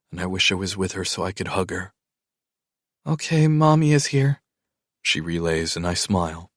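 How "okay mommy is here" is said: put-on higher, small child's voice; relieved little girl. A narrator's reading. Child on phone.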